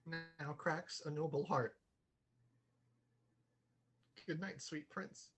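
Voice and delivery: very soft, grieving farewell; hushed mourning over the prince; hushed grief. Acted. Horatio mourns dead Hamlet.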